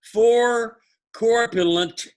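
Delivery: high, slow, loud singsong recital; sing song rote recitation. Shown as a demonstration. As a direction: draw out each item like a chant. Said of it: "Repeat after me".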